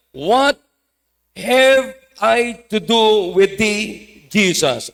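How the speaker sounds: slow, drawn-out preacher's version of the devil crying out; mild acted cry, dragged slowly; devil's cry, acted. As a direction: stretch the devil's words out solemnly. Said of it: "Anong sigaw niya?".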